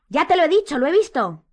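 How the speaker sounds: loud, high outburst; exasperated shout. A narrator's reading. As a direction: insist angrily at full pitch. Harry insists angrily in the quarrel.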